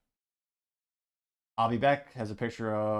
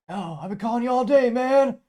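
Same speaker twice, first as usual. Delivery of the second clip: a bad, exaggerated take on Biden's voice; Biden impression; 13 semitones higher, 11 dB louder than usual. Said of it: "I'll try Biden's accent".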